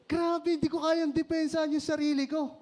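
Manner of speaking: helpless embarrassed laughter; laughing. Real, not acted. Laughing at his own embarrassment.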